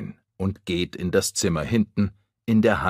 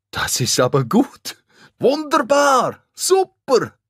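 much higher, louder delighted cries; excited cheer; 6 dB louder than usual. Acted. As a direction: burst out in joy. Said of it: "rufen alle". (alle jubeln laut und hoch über den Täter)

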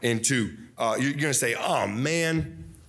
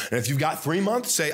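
ordinary talk

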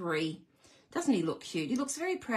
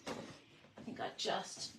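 muttered aside; 2 semitones lower, 13 dB quieter, slower than usual. Real, not acted. Muttering to herself mid-task.

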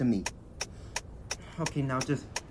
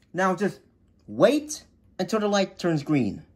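ordinary talk

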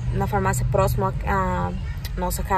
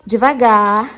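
ordinary talk

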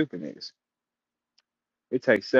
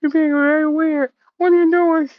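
loud, squeaky gremlin voice; hammed-up alarmed creature voice; Gizmo; 7 dB louder than usual. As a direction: squeal it high like a little gremlin. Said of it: "Little motherfucking gremlin said".

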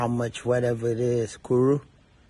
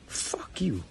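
whispered under the breath, much softer; whispered curse; 2 semitones lower, 8 dB quieter than usual. Real, not acted. Father cursing commenters who defend the abuser, whispered.

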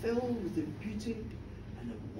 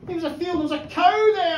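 ordinary delivery, nothing marked

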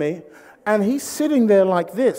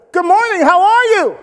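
high, loud, over-friendly holler; hearty greeting; 10 semitones higher, 7 dB louder than usual. Retold or imitated. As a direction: sing it out to a stranger as you jog by. Imitates jogger calling out greeting.

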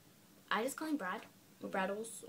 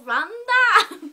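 mock shriek; 11 semitones higher, 17 dB louder than usual. Hammed-up voice game with brother.

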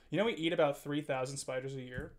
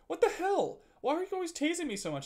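much higher, fast mock-annoyed mom; imitating mom; 14 semitones higher, 4 dB louder than usual. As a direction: toss off an exasperated girlfriend's complaint. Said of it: "she was like".

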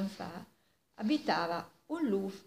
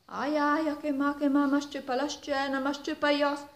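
fake pain; 6 semitones higher than usual. Fox faking pain after beating.

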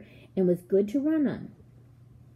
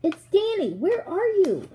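high, loud searching call; urgent call pitched way up; shouting; 11 semitones higher, 4 dB louder than usual. A narrator's reading. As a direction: shout to be found. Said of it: "I shouted".

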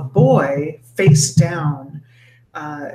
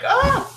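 startled cry. Acted. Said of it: "she's like".

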